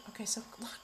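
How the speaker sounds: soft breathy whisper, drawing them in. Real, not acted. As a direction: whisper it like a secret. Hushed pointing at the card.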